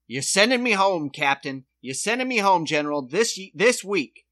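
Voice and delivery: hammed-up loud demand; mock shouting Klinger. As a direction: bellow it like a soldier begging to go home. Klinger quote, loud performed.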